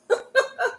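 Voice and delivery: short real high giggle. Real, not acted. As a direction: giggle at the teasing. Laughs thanking a viewer.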